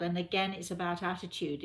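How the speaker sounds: normal talking voice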